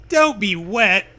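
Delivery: put-on loud, chiding girl voice; Zoe voice. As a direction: scold it like Zoe. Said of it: "Zoe tells you".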